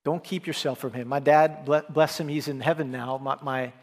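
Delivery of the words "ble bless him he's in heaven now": usual speaking voice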